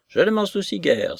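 high-pitched dismissive retort; exclaimed retort. A narrator's reading. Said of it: "s'écria Missus Honora".

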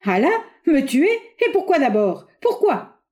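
high, indignant questions; furious. A narrator's reading. Bois d'Anguin answers the General's threat, furious. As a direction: fire back the questions, pitch leaping on the last. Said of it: "Bois d'Anguin, furieux".

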